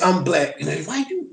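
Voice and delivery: ordinary talk, nothing marked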